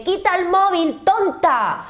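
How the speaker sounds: put-on high, cross little kid snapping; a child scolding his laughing mum; annoyed small child. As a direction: push the phone away like a fed-up toddler. Child tells mother off.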